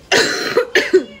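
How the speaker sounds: a real, hard chesty cough. Real, not acted. Coughing while describing the nights.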